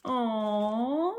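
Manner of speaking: ordinary delivery, nothing marked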